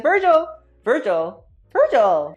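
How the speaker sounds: loud mock calling after Virgil; loud, hammed-up calls of Virgil. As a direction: shout the name to catch him. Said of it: "She's like".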